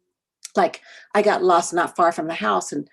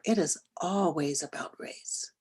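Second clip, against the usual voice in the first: much softer, almost whispered, weighty; hushed emphasis; 8 dB quieter than usual. Real, not acted. Speaker stressing race to the audience, whispered emphasis. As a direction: drop to a whisper to drive it home.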